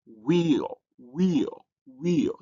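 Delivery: wheel repeated, each one set apart; pronunciation demonstration. Shown as a demonstration. Teacher showing the diphthong in a word, wheel carefully repeated. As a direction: say wheel as a model, opening like we. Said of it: "that's the way you pronounce it".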